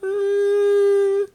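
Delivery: high, loud mocking voice of a clueless dresser; hammed-up high voice; mock inner voice. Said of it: "and you think".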